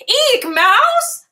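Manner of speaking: high startled elephant squeal; frightened shriek. Acted. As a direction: shriek at the word mouse. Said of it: "says Elephant".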